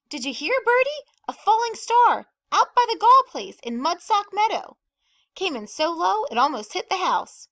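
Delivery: high, loud, breaking-news chatter; excited gossip. A narrator's reading. Carl phoning Bertie about the falling star, loud and excited. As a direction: spread the news at full excitement.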